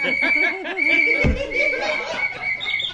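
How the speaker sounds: loud high shrieking laugh; laughter exploding into a shout; roaring laughter. Real, not acted. Audience roaring at the clown's last punchline.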